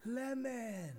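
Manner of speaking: high, distant called-out word; from another room. Acted. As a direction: call it from far away. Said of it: "Shouting the flavor from the other room".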